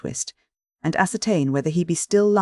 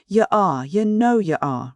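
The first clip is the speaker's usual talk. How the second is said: retorting Noah; 5 semitones higher, slower than usual. A narrator's reading. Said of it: "retorted Noah".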